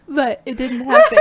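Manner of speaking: high, loud laughing words; laughing hard. Real, not acted. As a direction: speak through a laughing fit. Laughing fit.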